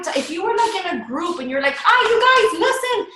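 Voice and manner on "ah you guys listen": high, gushing attention-grabber; excited girlfriend talk. Shown as a demonstration. Coach showing how to talk to a group, excited girlfriend talk. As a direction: burst in with news for your friends. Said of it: "So talk like that".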